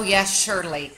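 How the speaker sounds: usual speaking voice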